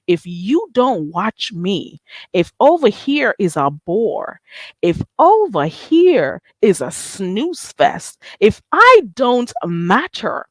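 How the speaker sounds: slow, drawn-out, each word weighed; slow pointed rant. Real, not acted. Calling out critics who say her channel bores, slow and pointed. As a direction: lay it out slowly to the haters.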